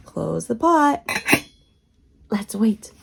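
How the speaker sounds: normal talking voice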